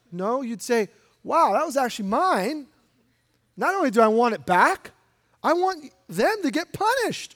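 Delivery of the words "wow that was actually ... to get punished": rising, higher indignant protest; indignant outrage. Retold or imitated. Preacher voicing a robbed homeowner, rising indignant protest. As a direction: demand justice, getting higher.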